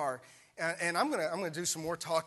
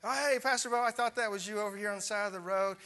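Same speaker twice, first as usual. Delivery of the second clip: higher, louder bright hello; a hint of a surprised churchgoer; cheery church member; 5 semitones higher, 5 dB louder, faster than usual. Church member spotting the pastor, lightly voiced surprise. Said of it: "and he says".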